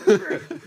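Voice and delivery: very high, loud squealing laugh; shrieking laugh. Real, not acted.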